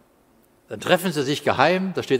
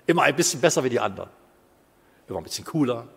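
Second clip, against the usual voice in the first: ordinary talk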